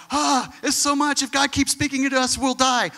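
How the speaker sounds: high, loud, mock-panicked plea; frightened people. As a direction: ham up the crowd begging to be spared. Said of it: "all the people are like".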